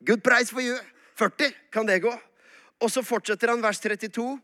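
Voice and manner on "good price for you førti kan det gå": lightly voiced market trader, higher pitch; mock bazaar haggler. Abraham haggling with God over forty, playful trader's patter. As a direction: pitch a deal like a haggling merchant.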